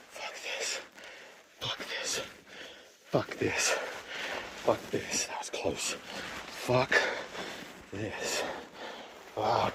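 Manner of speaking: whispered swearing in fear; breathless frightened whisper; scared whisper. Real, not acted. Scared on high staircase.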